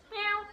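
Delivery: short high mew; imitated cat meow. Shown as a demonstration. Meowing when nobody's looking, imitated cat meow. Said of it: "I'll just do it, like, loads".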